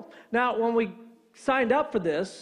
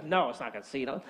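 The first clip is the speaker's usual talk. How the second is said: lower, softer, weary rejection; annoyed; 7 semitones lower, 5 dB quieter than usual. Retold or imitated. Alarm man rejects another wrong guess, annoyed. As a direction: turn the guess down with low annoyance. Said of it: "he was annoyed at me".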